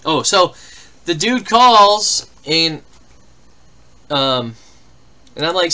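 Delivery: ordinary talk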